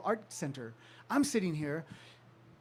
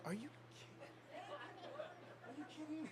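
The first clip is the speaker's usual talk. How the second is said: breathy, hushed disbelief, pitched up; stunned disbelief; 9 semitones higher, 19 dB quieter than usual. Retold or imitated. Hearing the voicemail from [name], hushed stunned disbelief. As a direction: gasp it out as if you can't believe it.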